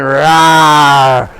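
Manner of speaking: loud child's pretend lion roar; playful lion roar. Child on the bed pretending to be Lord Nrsingadev, roaring playfully. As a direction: roar like a little lion god. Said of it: "pretending to be like Lord Nrsingadev".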